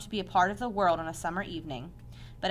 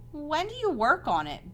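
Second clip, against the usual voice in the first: higher-pitched, mildly pleading question; begging; 8 semitones higher than usual. A narrator's reading. Pig begging the spider about her plan, mildly pleading. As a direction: press eagerly for an answer. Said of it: "begged Wilbur".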